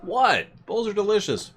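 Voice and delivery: high, loud shocked cry; mock shock. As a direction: squeal it out in disbelief. Talking to a bull in game.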